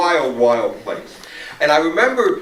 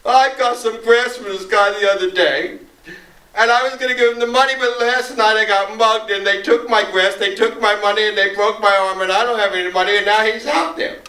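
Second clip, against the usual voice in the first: high, run-on tale of woe; imitated woeful plea; 7 semitones higher, 3 dB louder than usual. Joey explaining his debt to Marcia, imitated woeful plea. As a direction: whine it out like a kid in trouble. Upset kid tells his woes.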